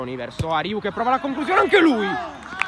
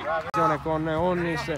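normal talking voice

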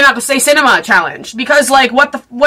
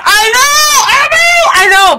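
high squealed protest, easing down; very high, emphatic pleading; defensive outburst; 11 semitones higher, 3 dB louder than usual. Real, not acted. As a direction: squeal it to head off objections. Fending off objections to controversial take.

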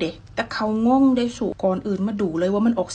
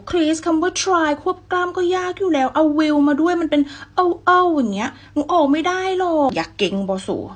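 higher, louder complaining student voice; whiny pupil; 7 semitones higher, 4 dB louder than usual. Pretend. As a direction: play the frustrated pupil. Pupil role complains about hard word.

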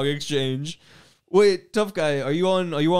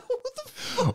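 laughing disbelief; 7 dB quieter, slower than usual. Retold or imitated. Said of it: "I was like".

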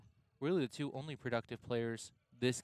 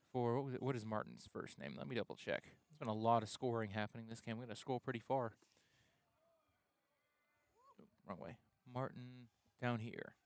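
muttering; 3 semitones lower, 8 dB quieter than usual. Real, not acted. Off-mic check while scrolling.

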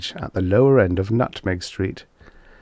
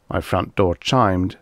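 usual speaking voice

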